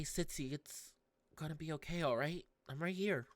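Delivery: ordinary talk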